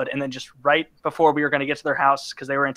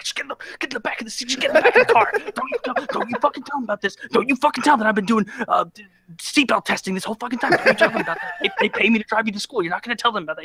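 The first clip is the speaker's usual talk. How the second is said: much higher, fast frantic orders; frantic pleading; 8 semitones higher, faster than usual. Retold or imitated. Begging the carpool kid not to tell his mom, frantic.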